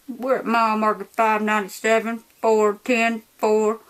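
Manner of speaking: clipped police radio report; lightly voiced cop on the radio; cop radio call.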